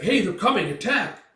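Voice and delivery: loud, high call to attack; rally the troops; battle cry. Acted. Gambino calling the attack in battle, loud battle cry.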